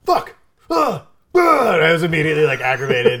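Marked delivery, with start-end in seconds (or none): 0.0-1.7 sharp high yelps of frustration; frustrated cry. Retold or imitated. Bartender shorted five cents, yelping in frustration. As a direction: let out the annoyance in yelps. Furious over being shorted.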